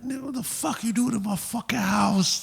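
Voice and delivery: high-pitched outraged imitation; angry confrontation. Baby's dad confronts the intruder in his house, angry high-pitched imitation.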